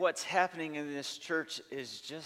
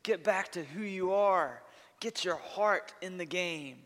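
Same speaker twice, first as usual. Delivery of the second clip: higher, pumped-up pep talk; rally the team back to belief; rallying coach; 5 semitones higher than usual. Retold or imitated. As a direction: coach firing up his losing team, rousing. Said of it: "on the other hand".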